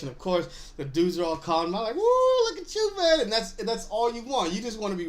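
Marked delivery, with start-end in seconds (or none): 1.9-3.2 loud high whoop of admiration; hyped-up praise. Retold or imitated. Classmates hyping his fresh jersey, excited whoop. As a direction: cheer him on like the guys in class.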